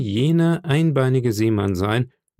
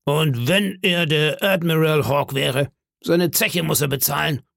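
higher-pitched landlord voice, mildly raised; indignant call; 5 semitones higher than usual. Acted. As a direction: insist indignantly on payment. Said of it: "rief Silver".

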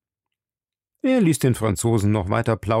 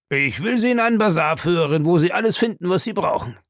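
higher-pitched, helpful agent; obliging Fix; 7 semitones higher, faster than usual. Acted. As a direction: offer your services to a new acquaintance. Voices Fix, the police agent.

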